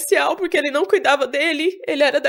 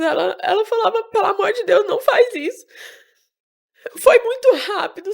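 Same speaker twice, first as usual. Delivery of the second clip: crying while telling; 6 semitones higher than usual. Real, not acted. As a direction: voice high and strained with real tears, speaking through sobs. Retelling mother's murder, crying audibly. (chorando de verdade, voz embargada e aguda)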